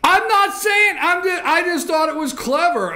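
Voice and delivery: loudly pleading his case; excited insisting. Real, not acted. Host insists cohost liked ad.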